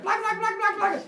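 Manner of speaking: very high, loud monster gibberish; yelling monster. Retold or imitated. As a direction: shriek nonsense like an attacking monster. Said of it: "and they're yelling at him".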